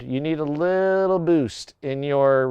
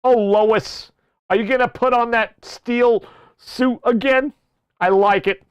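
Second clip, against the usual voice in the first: higher, put-on cartoon husband voice; playful, pitched-up husband impression; Peter; 6 semitones higher than usual. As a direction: flirt as a goofy cartoon dad. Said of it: "What if I talk like this, Lois".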